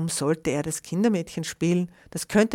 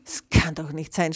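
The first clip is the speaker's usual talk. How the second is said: plain reading voice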